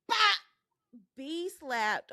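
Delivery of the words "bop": high, loud "bop" for the slap; narrating a face slap, loud high bop sound; mock slap sound. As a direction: pop the word out sharp and loud like a hit. Slap sound in retold fight.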